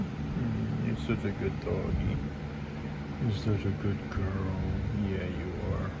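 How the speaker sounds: low, soft cooing to a dog; soft dog praise. Real, not acted. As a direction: murmur praise to the puppy.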